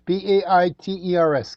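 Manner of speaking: B A I T E R S spelled letter by letter; spelling B A I T E R S out for listeners. Shown as a demonstration. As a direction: spell each letter of B A I T E R S clearly. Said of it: "Scam Baiters".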